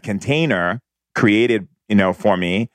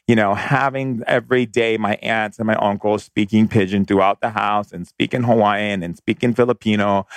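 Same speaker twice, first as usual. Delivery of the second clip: usual speaking voice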